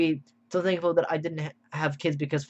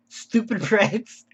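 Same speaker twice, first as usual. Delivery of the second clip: mock comment reading; 6 semitones higher, 4 dB louder, much slower than usual. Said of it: "the comment is".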